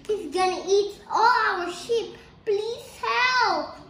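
loud, high pleading cry; acted desperate call to the villagers; shouting for help. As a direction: insist the danger is real, shouting. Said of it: "start shouting".

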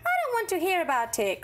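high, fast, loud protest; crying out. A narrator's reading. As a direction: blurt it out to shut her up. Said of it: "cried George".

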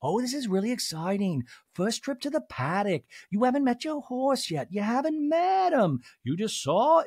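a mangled, drifting celebrity imitation; failed Jennifer Tilly impression. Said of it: "Now I'm doing Gina from".

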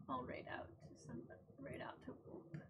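soft, tired whisper. Real, not acted. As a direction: barely voice it, drained. Tired streamer signing off.

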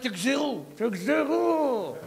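drawn out, cutting command; cold slow order. Retold or imitated. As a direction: other mother coldly tells the king to cut the baby, slow. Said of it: "והשנייה אומרת".

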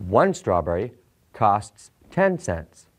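ordinary delivery

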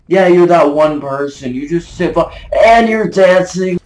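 high, loud exasperation, stretching the last words; exasperated rant. Real, not acted. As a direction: rant at the opponent, voice pushed high. Ranting at a dancing opponent.

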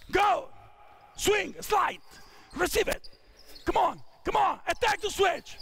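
high, urgent coaching calls; calling out. Real, not acted. Coach to players in a live drill, calling out. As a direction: call plays across the court.

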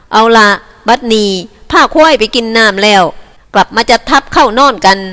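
loud ringing command to the camp. Acted. Cattle boss commanding his men, loud and ringing. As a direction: call it out to the whole herd. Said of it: "ด้วยเสียงดังกังวานว่า".

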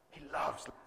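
a breathy whisper. Acted. Preacher whispering to the church of Jesus' love. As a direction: breathe it like a secret.

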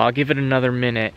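ordinary talk

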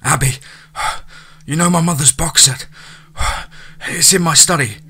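out of breath. A narrator's reading. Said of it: "Her fiancé panted".

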